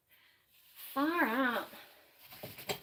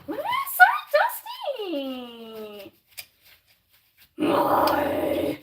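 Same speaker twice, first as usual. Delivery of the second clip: mock exasperated whine; 9 semitones higher, 3 dB louder, much slower than usual. Real, not acted. Dusty shelf, mock exasperation.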